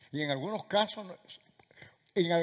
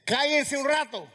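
loud, high order for silence; commanding shout; 8 semitones higher, 6 dB louder than usual. Acted. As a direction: call out sharply to hush a noisy crowd. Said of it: "¿qué tengo que decirte?".

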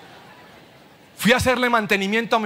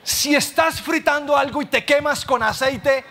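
ordinary delivery

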